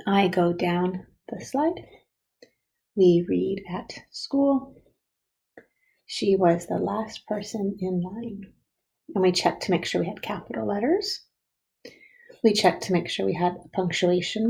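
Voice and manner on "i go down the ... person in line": measured reading for first graders; slow reading back. Shown as a demonstration. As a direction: read each sentence slowly, word by word. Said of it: "now you have your three sentences".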